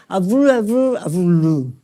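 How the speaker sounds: nonsense syllables with normal tune, in a changed voice; patient voice answering the doctor in fluent gibberish; fluent aphasic babble. Shown as a demonstration. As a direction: babble like a Wernicke patient who thinks she's talking. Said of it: "Entonces le digo".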